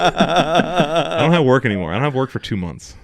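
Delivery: gleeful laugh at a friend about no work; laughing gloat. Real, not acted. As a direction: savor it with a laugh, drawn out. Gloating about summer break.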